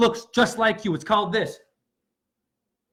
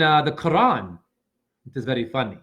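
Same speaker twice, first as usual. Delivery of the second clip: normal talking voice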